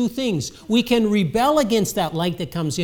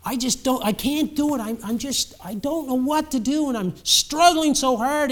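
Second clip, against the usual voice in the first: higher-pitched, mildly acted frustration; pleading a little, pitched up; struggling young man; 7 semitones higher than usual. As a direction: voice a young man at his wits' end. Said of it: "trying so hard to live".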